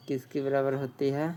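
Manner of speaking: ordinary talk, nothing marked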